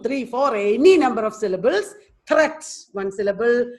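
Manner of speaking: normal talking voice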